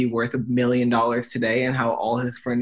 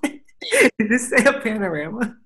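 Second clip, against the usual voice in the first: surprised; 9 semitones higher than usual. Real, not acted. Disbelief at friend's slip.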